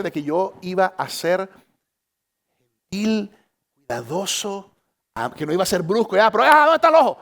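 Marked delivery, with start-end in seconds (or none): 6.2-7.2 flustered husband. Retold or imitated. Husband fumbling near wife's eye.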